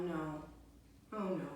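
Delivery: ordinary delivery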